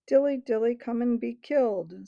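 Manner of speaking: loud, mocking sing-song sneer. A narrator's reading. Griffle jeering at the ape, sneering. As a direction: jeer at the ape's offer. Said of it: "sneered Griffle".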